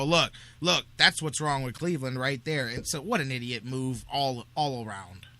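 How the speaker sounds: ordinary talk, nothing marked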